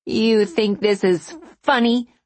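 high, outraged question; spitting anger. A narrator's reading. Wronged wife to the laughing showgirl, spat in anger. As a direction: spit it out at the giggler. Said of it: "the woman spat at Ruby".

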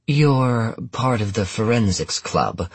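slow, doubtful question. Acted. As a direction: sound unconvinced, drawn out. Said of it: "the medical examiner said doubtfully".

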